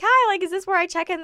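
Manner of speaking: high, loud and eager; bright high question. Retold or imitated. Asking the check-in desk, high and eager.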